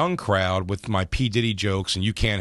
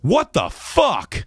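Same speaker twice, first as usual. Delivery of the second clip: slow, much higher, drawn-out disbelief; stunned heckler; 8 semitones higher, 3 dB louder, much slower than usual. Retold or imitated. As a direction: let it hang like a stranger in the back. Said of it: "a voice in the back just goes".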